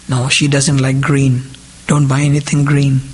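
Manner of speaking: normal talking voice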